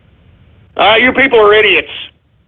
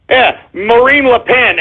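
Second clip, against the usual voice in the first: ordinary delivery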